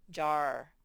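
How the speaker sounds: ordinary talk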